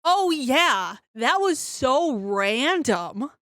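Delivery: loud, high, drawn-out amazement; excited agreement. Real, not acted. Co-host recalling the soccer game with the guards, excited and amazed. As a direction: jump in loudly, stretching out the disbelief.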